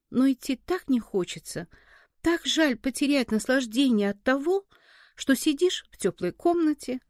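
usual speaking voice

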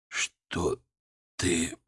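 low, halting words; weak, failing voice. A narrator's reading. Collapsing patient to doctor, weak and halting. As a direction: barely get the words out.

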